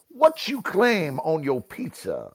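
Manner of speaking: punchy, swinging "What You Claim on Your Pizza"; sassy game inflection. Shown as a demonstration. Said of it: "that kind of inflection".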